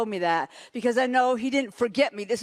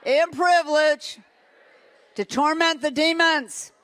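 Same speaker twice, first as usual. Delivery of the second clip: louder, higher declaration for the crowd to repeat; model declaration; 4 semitones higher, 5 dB louder than usual. Shown as a demonstration. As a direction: proclaim it boldly.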